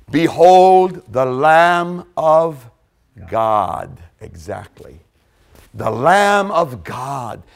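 slow, hushed scripture words; solemn proclamation. Acted. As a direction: let it land with reverence, slowly.

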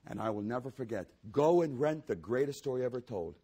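usual speaking voice

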